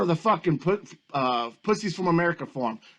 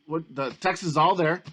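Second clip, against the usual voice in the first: normal talking voice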